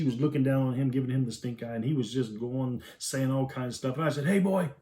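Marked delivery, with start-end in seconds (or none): 4.3-4.7 stern dad call. Retold or imitated. Dad scolding son.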